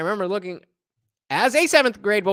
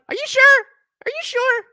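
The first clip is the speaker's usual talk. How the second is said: high-pitched mimicked disbelief; mock incredulous; 6 dB louder than usual. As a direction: squeak it, imitating his doubt. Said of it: "He was like".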